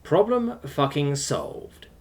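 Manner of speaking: ordinary delivery, nothing marked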